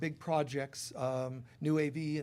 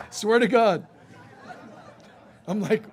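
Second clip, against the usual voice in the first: laughing; 6 semitones higher, 6 dB louder than usual. Real, not acted. Laughing through the punchline.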